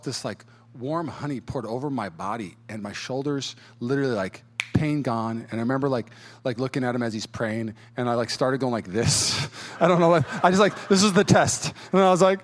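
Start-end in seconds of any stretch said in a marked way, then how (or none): none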